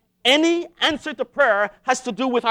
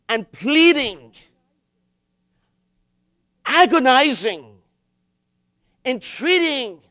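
slow, loud, drawn-out entreaty; forceful, agonized preaching; pleading emphasis; 7 dB louder, slower than usual. Acted. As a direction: stretch each word out as an earnest plea. Preacher enacting pleading prayer words.

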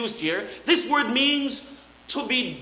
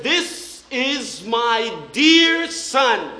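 slow solemn voice; much slower than usual. Retold or imitated. Voice from heaven quoted.

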